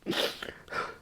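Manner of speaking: acted breathy sobbing; crying. Storyteller reenacting his breakdown on the floor, crying. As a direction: imitate gasping, wordless sobs. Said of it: "I'm crying".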